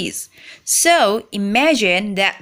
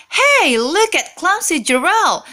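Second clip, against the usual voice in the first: sneering; 7 semitones higher than usual. A narrator's reading. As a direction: taunt him from across the floor. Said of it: "the animals all sneer".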